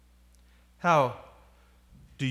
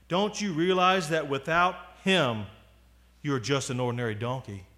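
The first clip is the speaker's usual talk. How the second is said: ordinary delivery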